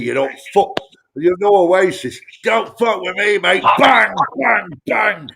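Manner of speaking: playful Northern English menace, punchy and loud; mock northern hard man. Tony hams up a northern English hard man for the host, mock threatening. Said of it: "I'm from up north, mate".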